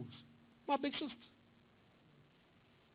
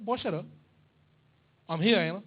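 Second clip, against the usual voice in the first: loud, snappy big sister; tough sister; 11 dB louder than usual. Retold or imitated. Big sister snaps at brother, loud and tough. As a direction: shut him down hard.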